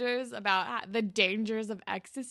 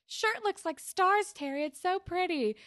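imitated gushing compliment; 10 semitones higher than usual. Ashley being nice to Terry, high gushing voice. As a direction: gush in a high, dreamy voice. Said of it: "Ashley's not a bitch to Terry".

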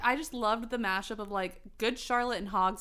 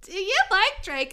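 high, loud, gushing question; excited friend; 9 semitones higher, 7 dB louder than usual. Acted. Friend asking about Draco, excited and high. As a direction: squeal it like a valley girl.

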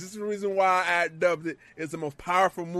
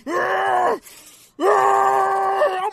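high mock yells, half screamed; imitated yelling; 14 semitones higher, 9 dB louder than usual. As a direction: yell like a brute choking someone, hammed up. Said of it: "just yelling as he's choking Drew".

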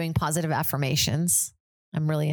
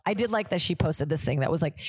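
normal talking voice

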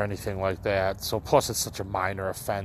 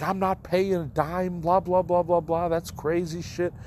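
pitched-up mock yell at the office; retold yelling; 8 semitones higher than usual. Retelling how he yelled at the Forest Preserve office, a mild mock yell. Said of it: "I started yelling at him".

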